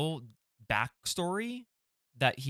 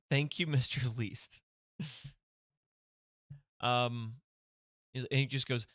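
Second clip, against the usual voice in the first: ordinary talk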